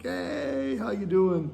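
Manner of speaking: high, bright "Hey" sliding down into a cheerful how you doing; excited greeting. Retold or imitated. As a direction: imitate a friend's booming Italian hello. Friend's big greeting at meetings.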